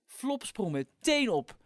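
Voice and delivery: ordinary delivery, nothing marked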